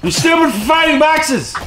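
high-pitched exasperated scolding; exasperated yell. Real, not acted. Player scolds his minions for fighting boxes, exasperated yell.

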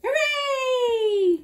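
high, loud hooray; cheer. Acted. Silky's friends cheer at the invitation to tea.

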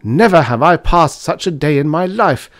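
high, loud outraged complaint; exasperated man's outburst; indignant cry. A narrator's reading. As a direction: cry it out as if stamping in rage. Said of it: "von Hartmann cried, stamping upon the floor".

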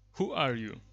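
projecting a command at the microphone; loud voice command. Real, not acted. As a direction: call it out crisply so the software picks it up. Commands spoken to speech recognition.

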